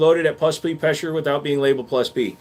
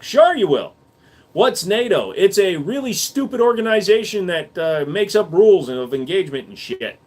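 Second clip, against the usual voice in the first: ordinary talk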